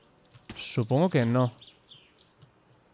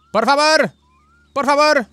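higher, louder begging cry; pleading shout; 9 semitones higher, 4 dB louder, slower than usual. Pretend. Stranded driver begging a car to stop, loud plea. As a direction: beg the passing car to stop.

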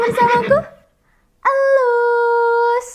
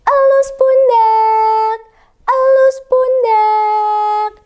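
slow gentle; much slower than usual. Shown as a demonstration. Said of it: "gerakan pelan-pelan".

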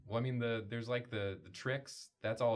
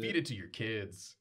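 slow ad tagline read. Acted. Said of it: "That's the tagline".

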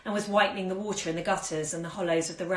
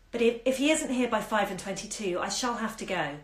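ordinary delivery, nothing marked

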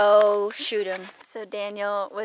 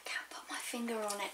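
whispered musing; 16 dB quieter, faster than usual. Real, not acted. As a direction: say it under the breath, fast. Tasting, whispered.

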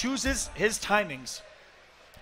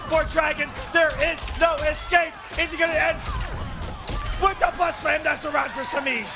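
excited esports commentary; 7 semitones higher than usual. Acted. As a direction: build the hype to the round win. Building hype call to round end.

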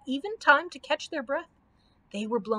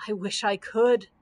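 lightly voiced groaning lion; 3 dB louder than usual. A narrator's reading. Cowardly Lion answers about the forgotten parasol, mild groan. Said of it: "groaned the Cowardly Lion".

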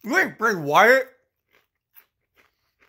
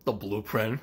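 muttered sneer; 11 semitones lower, 10 dB quieter, slower than usual. Acted. Sneering at Morgan's nickname.

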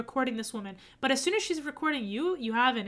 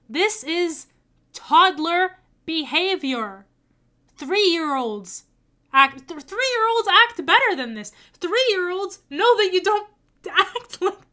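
exasperated scolding; 4 semitones higher, 6 dB louder, slower than usual. Real, not acted. Scolding about toddler behavior.

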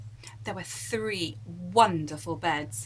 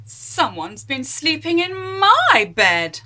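rumbling Mummy Bear; 6 semitones higher, 9 dB louder than usual. Acted. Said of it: "rumbled Mummy Bear".

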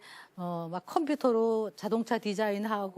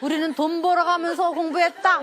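louder, higher nagging parent, lightly hammed; mock lecturing parent; 5 semitones higher, 5 dB louder than usual. Speaker mocking parents lecturing their kids, hammed-up nagging. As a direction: parody the old-days sermon.

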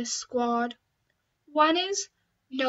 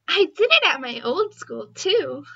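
ordinary talk

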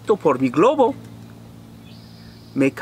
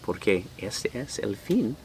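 put-on much softer, lower words to the kids; quiet aside; 4 semitones lower, 11 dB quieter than usual. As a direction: lean in and ask the listeners softly. Reader asks the children directly. (pregunta suave a los niños al final del libro)

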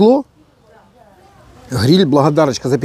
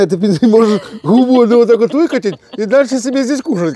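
mimicking wife; 6 semitones higher than usual. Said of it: "как ты говоришь".

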